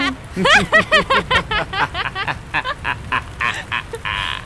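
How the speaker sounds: soft, long falling villain laugh; long trailing cackle. Acted. Joker leaving Dick with drugged Raya, fading cackle. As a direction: let the cackle fade away.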